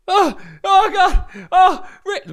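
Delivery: very high, slow, distressed call; imitating Rosie from another room. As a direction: call out in a high panicked wail. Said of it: "Rosie's voice".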